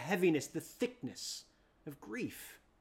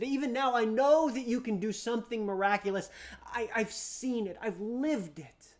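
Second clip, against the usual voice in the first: urgent, louder pleading, rushing then slowing; 6 semitones higher, 11 dB louder than usual. Acted. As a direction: beg him with faltering hope. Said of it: "She, she's almost pleading".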